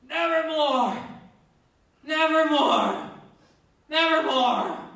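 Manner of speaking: high, loud anguished scream. Retold or imitated. Poe lying in his blood, screaming high and loud. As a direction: howl it out dying in a pool of blood.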